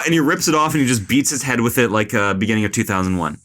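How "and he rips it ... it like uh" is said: ordinary talk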